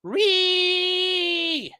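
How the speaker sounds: high drawn-out mocking shriek; mock whiny shriek; mock screech. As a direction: imitate a shrieking meltdown. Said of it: "you've gone down in history going".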